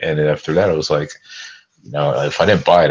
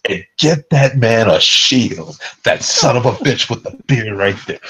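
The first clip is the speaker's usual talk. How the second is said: slow, higher and louder regal call; 6 semitones higher, 3 dB louder than usual. Retold or imitated. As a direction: proclaim it like the king, hammed-up. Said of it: "And so it goes".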